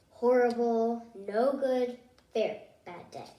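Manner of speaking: ordinary delivery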